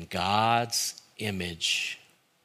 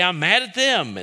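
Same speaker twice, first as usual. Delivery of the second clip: high, loud acted indignation; worked-up protest; 8 semitones higher, 6 dB louder than usual. Worked-up speaker explaining to a friend, acted heated protest. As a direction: insist heatedly on who the target is. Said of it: "And then they say".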